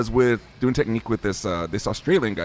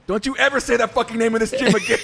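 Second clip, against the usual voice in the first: higher-pitched mock-indignant scolding; retold outraged warning; 11 semitones higher, 4 dB louder than usual. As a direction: imitate an immediate, heated telling-off. Retold outraged rebuke at the gym.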